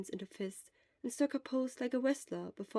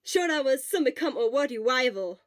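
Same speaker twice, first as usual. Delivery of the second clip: loud, bright cheer; cheerful shout; 4 semitones higher, 8 dB louder than usual. Acted. Obo announcing his friend as rival, cheerful shout. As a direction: call it out proudly. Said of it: "Obo shouted cheerfully".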